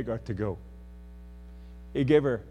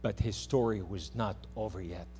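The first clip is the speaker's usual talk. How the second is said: usual speaking voice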